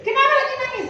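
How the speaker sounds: suara oma lebih tinggi, sambil tertawa; nenek bertanya. Retold or imitated. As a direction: oma menanyai cucu, geli. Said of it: "kata omanya". (oma bertanya kepada cucu sambil tertawa)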